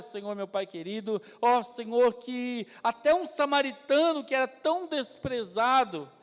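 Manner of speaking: earnest pastor leading the church in prayer; oração fervorosa de pastor; closing prayer. Acted. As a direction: pray fervently over the congregation.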